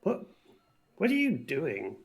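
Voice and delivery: high, puzzled call of a name; confused henchman checking on his boss; crew member's call. Acted. Henchman questioning his captured leader, high and puzzled. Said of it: "one of them just goes".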